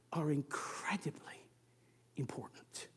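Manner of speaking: hushed whisper for weight. Real, not acted. Preacher to congregation, whispering the key point. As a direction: lean in and whisper it.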